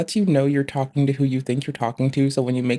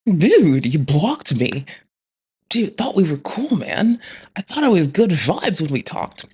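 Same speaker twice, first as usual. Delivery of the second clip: surfer voice; 3 semitones higher, 4 dB louder, slower than usual. Acted. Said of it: "we did surfer voice".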